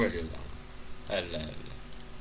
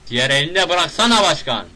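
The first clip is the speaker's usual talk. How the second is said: loud, raised-pitch scolding; exasperated shouting; 6 semitones higher, 11 dB louder than usual. Real, not acted. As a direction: yell at the game character. Scolding the game character.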